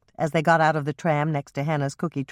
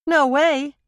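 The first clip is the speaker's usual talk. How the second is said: higher, louder flat no; emphatic refusal; 12 semitones higher, 5 dB louder than usual. A narrator's reading. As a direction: shut the idea down hard. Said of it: "Andrea said emphatically".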